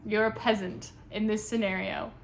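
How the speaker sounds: normal talking voice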